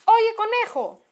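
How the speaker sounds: high, drawn-out call from outside. A narrator's reading. Someone outside calls to the rabbit, calling out. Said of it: "gritó alguien desde afuera".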